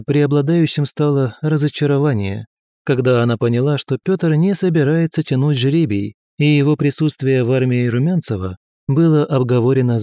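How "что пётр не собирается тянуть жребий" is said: usual speaking voice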